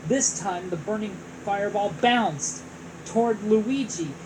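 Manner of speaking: ordinary delivery, nothing marked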